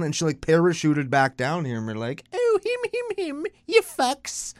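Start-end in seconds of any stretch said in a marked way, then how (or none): 2.3-4.5 high, put-on bad British accent; mock British accent, way up high. Mocking the Queen parachuting back, bad British accent. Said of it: "That's my British accent".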